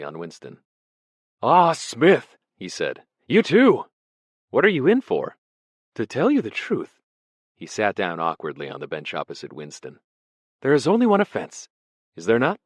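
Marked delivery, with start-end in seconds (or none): 1.4-7.0 high, loud, fluttery scholar's voice; a vague, startled prisoner greeting an old colleague; nervous poet. Acted. Ampleforth greets Smith in the cell, high and startled. 10.6-12.6 high, loud, fluttery scholar's voice; a vague, startled prisoner greeting an old colleague; nervous poet. Acted. Ampleforth greets Smith in the cell, high and startled.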